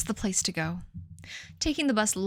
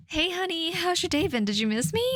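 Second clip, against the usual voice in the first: higher, fake sugary greeting; sweet talk pitched well up, falsely eager; fake enthusiasm; 8 semitones higher than usual. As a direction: push the voice up with forced cheer. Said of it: "I pushed up my voice a, up a pitch".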